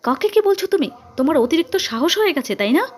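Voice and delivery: high, loud scolding; angry shout. A narrator's reading. Mother scolding Sudipa for her nerve, angry shout. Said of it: "চিৎকার করে বলল".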